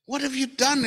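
high-pitched retold cry of dismay; retold outcry. The people crying out to Moses, high retold outcry. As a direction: cry out in protest, pitched well up. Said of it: "they cried out to Moses".

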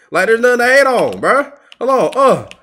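usual speaking voice

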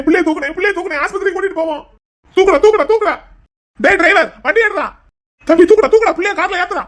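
high, urgent shouted orders; panicked urging. Acted. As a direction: hurry everyone to lift the patient. Family rushing patient to hospital.

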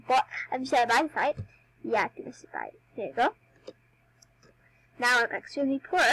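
ordinary delivery

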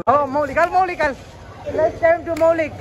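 high-pitched showman's call; hyped-up announcing. Pretend. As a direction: call the friend up to perform. Hypes up friend's performance. (ઊંચા અવાજે ઉત્સાહથી બોલાવવું)

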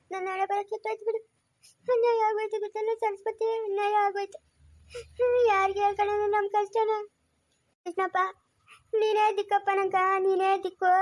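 weepy wail with dragged-out words; tearful lament. Acted. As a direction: plead helplessly through tears. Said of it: "ನೀನ್ ಅಳ್ಬೇಡ ಜಯಮ್ಮ". (ಅಳುತ್ತಾ ದೀನವಾಗಿ ಗೋಳಾಡುವುದು)

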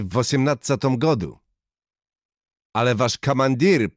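put-on high, loud sneering Russian-accented speech; Russian-accented harangue. A narrator's reading. Soviet major mocking the surrendered townspeople, loud Russian-accented harangue. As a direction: harangue the crowd with contempt.

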